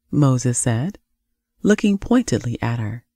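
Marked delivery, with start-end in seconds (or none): none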